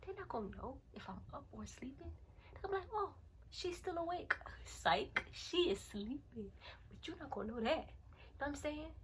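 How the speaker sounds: very soft near-whisper; hushed, conspiratorial. Pretend. Telling viewers how an intruder gets fooled, hushed. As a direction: drop to a hush as if someone is sneaking up.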